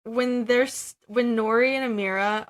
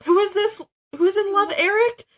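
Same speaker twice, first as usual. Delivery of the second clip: excited nosy wife; 11 semitones higher, 6 dB louder than usual. Retold or imitated. Wife excitedly bursts out of car.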